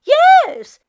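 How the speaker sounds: put-on excited old woman squeal; exclaiming. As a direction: shriek yes yes eagerly. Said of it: "Meshka exclaimed".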